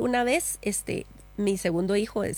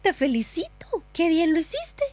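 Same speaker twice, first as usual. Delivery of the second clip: put-on higher, bright praise for a toddler; proud mom congratulating her child; excited praise; 7 semitones higher than usual. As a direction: cheer the little one on. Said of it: "yo le decía".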